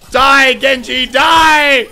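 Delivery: high, loud battle shout; yelling at an enemy. Real, not acted. Gamer yelling at an enemy in the match, loud battle shout. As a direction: scream it at the enemy.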